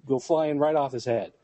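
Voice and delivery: ordinary delivery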